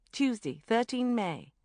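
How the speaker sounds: plain reading voice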